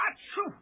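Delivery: sharp high sneeze. Acted. Magician sneezing amid the bees, high sneeze. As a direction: let a sneeze burst out.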